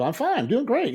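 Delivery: high, bright mock-cheerful fine; chirpy brush-off. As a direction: hide it behind a bright high voice. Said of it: "And I go".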